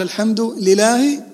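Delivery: لله as li-lahi, one short L; slow الحمد لله without doubling the L in لله; missing shadda. Shown as a demonstration. Teacher repeating the invalid reading, single L in لله. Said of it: "لأنك لم تشدد لفظ الجلالة".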